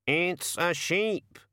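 high, slow raven voice; raven noticing. Acted. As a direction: raven dawning on a find. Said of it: "it said".

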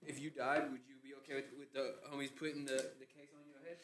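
soft, nearly whispered question; quiet. Real, not acted. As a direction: drop to a hush. Asks quietly, trailing off.

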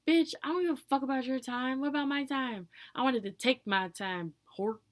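loud, high-pitched outraged outburst; ranting comeback at full volume; angry retort. Acted. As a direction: fire back at them, indignant and loud. Acted angry retort to complainers.

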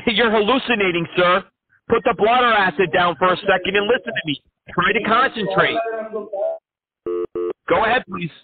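high, excited ranting crank; manic caller. Acted. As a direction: rant like an unhinged crank caller. Crank caller rants at scammer.

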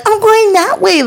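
high-pitched indignant protest; exaggerated retold huffiness; mock irate driver. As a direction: imitate a worked-up driver insisting on his way. Imitating the pointing angry driver.

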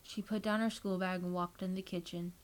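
plain reading voice

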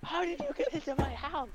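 very high-pitched mock-teacher squeal; hammed-up high voice of a shocked teacher; outraged teacher. Echoing the outraged teacher line.

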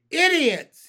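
very loud high-pitched yell; yelling insult. A narrator's reading. Centipede yelling at the Cloud Men, full-volume shout. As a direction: hurl it as loud as you can. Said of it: "he yelled".

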